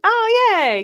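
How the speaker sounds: high delighted yay; excited cheer. Real, not acted. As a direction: cheer them on. Cheering a commenter's compliment.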